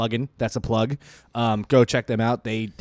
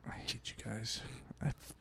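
whispered mock hatred; 20 dB quieter than usual. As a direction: breathe it out, unvoiced.

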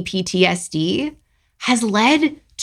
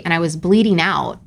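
ordinary talk, nothing marked

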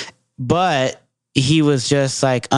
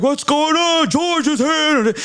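much higher, slow, big goofy character voice; hyped coworker character; 13 semitones higher, slower than usual. Retold or imitated. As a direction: belt it like a clowning coworker making everyone laugh. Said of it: "this full-on characters".

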